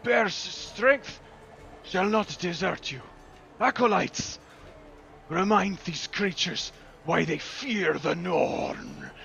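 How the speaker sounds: high, loud battle cry from a bear priestess; rally the troops in a husky voice; husky woman's voice. A narrator's reading. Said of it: "a husky feminine voice that says".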